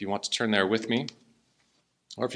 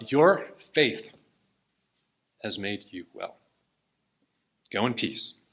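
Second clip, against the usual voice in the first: reading Jesus' words through real tears; choked-up, tender reading; 2 semitones lower than usual. Real, not acted. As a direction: voice wavering while reading. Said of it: "I'm tearing up".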